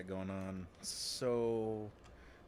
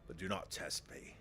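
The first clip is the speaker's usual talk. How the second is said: menacing city leader; 4 semitones lower than usual. Acted. Leader to striking workers, low and menacing.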